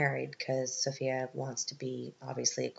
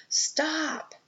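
much higher, sharp "Stop!"; mock shout. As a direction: cut the ceremony off with one high cry. Amber interrupts the coronation.

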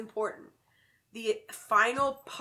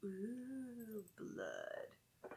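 spooky whisper; 16 dB quieter than usual. Acted. Spooky aside about blood.

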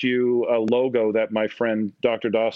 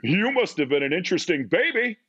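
put-on old guy; 6 semitones higher than usual. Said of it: "this old guy turned around".

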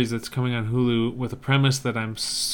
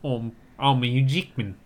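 Richard Taylor impression. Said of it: "I did launch into Richard Taylor".

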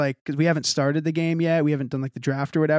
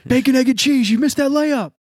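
higher, louder teasing call across the court; mock friends taunting; 10 semitones higher, 6 dB louder than usual. Friends on the basketball court mocking him, loud high teasing.

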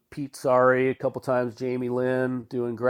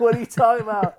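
mock disbelief; 10 semitones higher, 6 dB louder, faster than usual. Doug quoted, incredulous with laugh.

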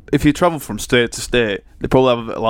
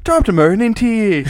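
higher-pitched mock Irish accent; 8 semitones higher than usual. Friend asks for Irish, shaky attempt at Irish accent. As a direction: attempt a high Irish lilt. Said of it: "Can you do Irish?".

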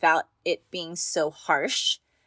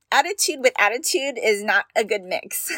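ordinary delivery

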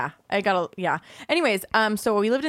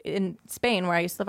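usual speaking voice

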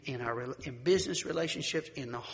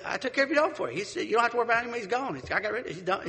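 high, louder cheerful neighbor voice; proud neighbor; 8 semitones higher, 5 dB louder, faster than usual. Retold or imitated. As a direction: chirp it out like a proud helper.

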